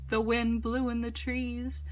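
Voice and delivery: ordinary delivery, nothing marked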